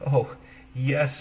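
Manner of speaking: put-on a cheerful young girl answering.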